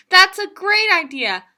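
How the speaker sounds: high and loud with glee; excited. Acted. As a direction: burst out with a bright idea. Rhino gets excited.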